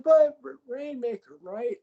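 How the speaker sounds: high, pleading squeak; high, pleading squeak of Okada; Okada impression. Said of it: "He's like".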